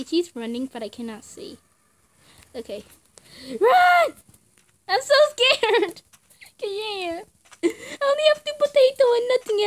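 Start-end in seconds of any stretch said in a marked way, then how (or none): 3.6-6.0 high, loud cry of fear; panicked yell. Real, not acted. Gamer panicking as she is chased. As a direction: yell run, then whimper scared.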